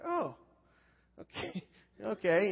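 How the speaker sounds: high, slow disbelieving okay with a laugh; stunned okay. Retold or imitated. New guy hearing his harsh boss is a churchgoer, laughing in disbelief. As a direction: let the surprise leak out in a laugh.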